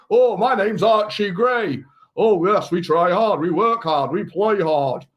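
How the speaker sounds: hammed-up Leeds lad accent; mock Leeds accent with drawn-out vowels; Archie Gray. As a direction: play a young footballer with a broad Leeds accent. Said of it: "probably talks like a fifteen-year-old from Leeds".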